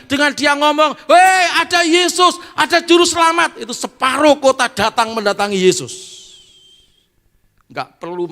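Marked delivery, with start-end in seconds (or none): 0.1-0.9 high, loud, shouting sermon climax; roaring revival preacher; fiery preaching. Acted. Preaching at full force. 1.1-3.6 high, loud joyful call to the town; thrilled proclamation; excited announcement. Acted. Samaritan woman announcing Jesus. 3.6-5.9 high, loud, shouting sermon climax; roaring revival preacher; fiery preaching. Acted. Preaching at full force.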